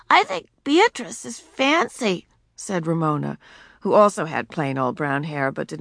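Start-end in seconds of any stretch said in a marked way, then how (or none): 0.1-2.3 put-on high, slow little-girl voice; sweet small girl voice. A narrator's reading. Ramona voiced in story.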